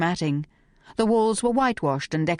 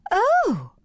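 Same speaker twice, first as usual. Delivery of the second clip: high, loud gasped oh; startled gasp; 4 dB louder than usual. A narrator's reading. Housemate startled at meeting Phryne, high gasp. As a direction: gasp in surprise. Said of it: "gasped Miss Gallagher again".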